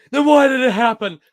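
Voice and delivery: high, loud accusing line reading; acted movie line. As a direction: demand an answer, hammed up. Acting out movie scene dialogue.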